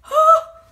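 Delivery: sharp high loud gasp; acted-out gasp of shock. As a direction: gasp out of sheer disbelief. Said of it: "I was like".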